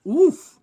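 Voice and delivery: loud, high oof; real wince let out as a grunt; short, sharp oof of pain. Real, not acted. Still hurting from the dogs.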